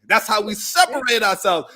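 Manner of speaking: usual speaking voice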